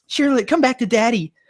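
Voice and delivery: high, sing-song doting call to a pet; high pet-parent voice; doting trainer. Acted. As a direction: coo at your little girl to come back.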